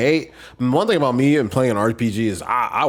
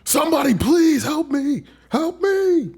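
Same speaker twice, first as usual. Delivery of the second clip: high whiny cry for help; desperate cry. Real, not acted. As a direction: wail for help.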